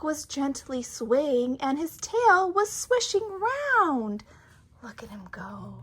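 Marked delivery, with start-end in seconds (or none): 4.8-5.8 hushed, breathy aside; whispering. Real, not acted. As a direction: whisper it in awe. Whispered aside while reading.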